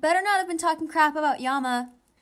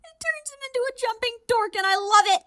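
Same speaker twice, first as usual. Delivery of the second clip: higher and louder gushing; 11 semitones higher, 6 dB louder than usual. Real, not acted. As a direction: gush like a delighted fan. Gushing over anime character.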